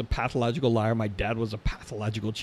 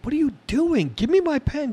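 startled protest; 4 dB louder than usual. Retold or imitated. Retold self, startled.

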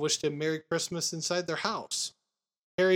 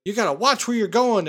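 high, loud mock yell; Harry yelling at the boy out the car window; retold yell; 5 semitones higher, 7 dB louder than usual. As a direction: shout it down from the car. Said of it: "yells down his window".

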